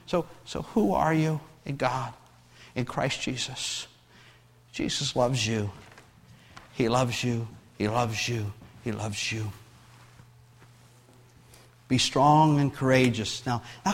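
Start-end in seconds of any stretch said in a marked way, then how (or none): 0.1-9.5 softer and softer, lower, tender; tender hush. Acted. Pastor assuring each listener of Jesus's love, fading softly. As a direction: let it fade into a soft, lowered reassurance. Intimate close after preaching.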